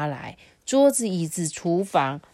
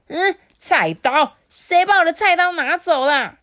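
a cook shrieking at an empty knife rack; furious yell; 12 semitones higher, 9 dB louder than usual. Acted. Younger brother yelling that someone took his cleaver, outraged.

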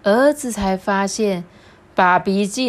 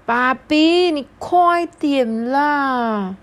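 higher, whiny and drawn-out plea; son begging; 8 semitones higher, 4 dB louder, much slower than usual. Acted. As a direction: whine at dad to hurry up. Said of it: "苦苦哀求着爸比".

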